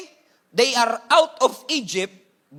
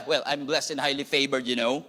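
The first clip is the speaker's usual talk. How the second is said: sassy put-on reply; 9 semitones lower, 4 dB quieter than usual. Shown as a demonstration. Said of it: "With an accent, with an attitude, with an eyebrow".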